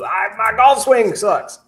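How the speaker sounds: put-on loud, high-pitched Southern accent. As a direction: play an aching Southern golfer selling a remedy, accent thick. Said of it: "I did a Southern accent on that".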